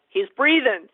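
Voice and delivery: loud, high, laughing exclamations; half-laughing, punched-up nurse impression; mock ER-nurse exclamation. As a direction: call it out like it's plenty good enough. Mimics easily satisfied ER nurses.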